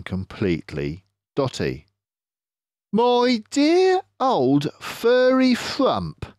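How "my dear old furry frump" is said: slow, raised sly fox; a drawn-out, knowing Mister Fox. Acted. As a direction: clever fox leader, pleased with himself.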